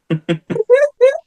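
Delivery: shrieking laugh, pitch way up; high squealing laugh. Real, not acted. Laughing at her own wrestling story, high squeal.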